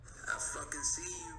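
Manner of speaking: whispered taunt. Real, not acted. Podcaster whispering at a rat, unvoiced. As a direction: hiss it without voice.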